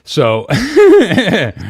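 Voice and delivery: real laugh breaking through the words. Real, not acted. Streamer cracking up while telling his co-host about his wife, laughing loudly. Cracks up retelling wife's reaction.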